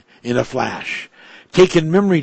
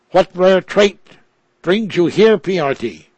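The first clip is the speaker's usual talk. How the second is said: higher and louder, a mocking jab; taunting; 5 semitones higher, 4 dB louder than usual. A narrator's reading. Robot Ripples taunting its creator Piorti, higher and mocking. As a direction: needle him with a raised, sneering question. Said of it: "taunted Ripples".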